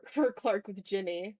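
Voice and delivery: ordinary talk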